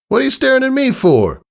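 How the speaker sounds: high, teasing coach voice; Rainbow Dash voice. A narrator's reading. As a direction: tease her lightly.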